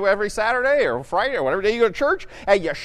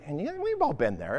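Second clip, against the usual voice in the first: ordinary talk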